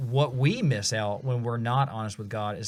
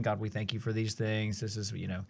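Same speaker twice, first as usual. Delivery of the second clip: rote prayer; 3 semitones lower, 7 dB quieter, faster than usual. Retold or imitated. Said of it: "the kind of rote prayers that we're used to praying".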